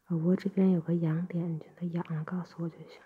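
gentle ear-cleaning whisper. Acted.

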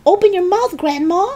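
very high, urgent call; urgent cry. A narrator's reading. George with a jug of water, crying out. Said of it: "he cried".

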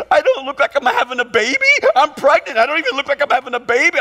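imitated wife wailing in a rush; hammed-up hurt protest from a pregnant wife; mock bawling. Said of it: "She starts bawling".

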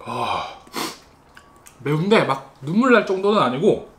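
chewing while talking; talking between bites; mouth full of noodles. Real, not acted. Seen 0: Mouth looks full, lips pushed out and working after eating noodles.

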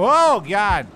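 high, loud panicked yelp; real fright at a sudden jump scare; shrieking as a monster pops out in the dark; startled scream. Real, not acted.

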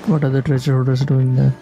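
ordinary talk, nothing marked

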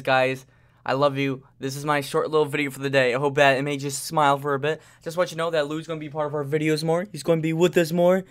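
usual speaking voice

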